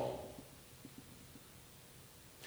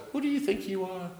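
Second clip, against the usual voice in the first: higher, softer rebuke; Jesus scolding the disciples, higher and hushed; Jesus's rebuke; 5 semitones higher, 8 dB quieter than usual. Acted. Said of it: "is saying to his disciples".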